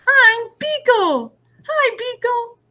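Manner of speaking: high, slow storybook voice for Beagle and Alice; character voice. Acted. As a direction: lift into a sweet, high picture-book voice. Character voices in picture book.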